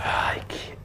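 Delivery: tense, unvoiced whisper. Real, not acted. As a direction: hush it under the breath. Tense moment watching the match.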